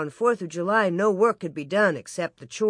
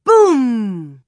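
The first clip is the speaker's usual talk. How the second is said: booming explosion; 4 semitones higher, 10 dB louder than usual. A narrator's reading. Cannon-like blast jolting sleepers awake, booming. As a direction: sound the blast, long and falling.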